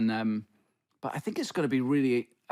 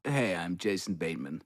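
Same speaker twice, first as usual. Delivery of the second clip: put-on American accent; 8 semitones lower, 4 dB quieter than usual. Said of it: "a little bit of an American accent".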